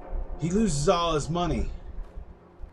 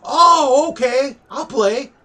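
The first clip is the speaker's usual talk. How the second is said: mock eager customer; 11 semitones higher, 9 dB louder, slower than usual. Mock customer quote, high voice.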